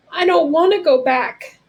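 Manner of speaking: loud, high blurt of panic; frightened boy blurting to Momo Bay. A narrator's reading. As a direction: burst it out before you can stop it. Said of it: "I blurted out".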